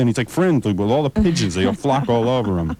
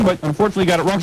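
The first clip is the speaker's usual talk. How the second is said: usual speaking voice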